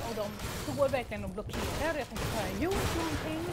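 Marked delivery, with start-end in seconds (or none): 2.4-3.5 higher, louder anxious question to herself; sudden worry that she did something; retold panic, higher and louder; nervous thought. Panicked thought under police stare.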